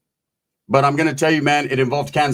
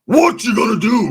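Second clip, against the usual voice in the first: lightly voiced wrestler promo; Hulk Hogan catchphrase; 6 semitones higher than usual. Former Hulkamaniac quoting his hero, mildly hammed-up. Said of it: "I used to be a little fucking Hulkamaniac".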